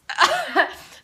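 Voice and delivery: high loud burst of laughter. Real, not acted. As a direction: laugh out hard. Big laugh.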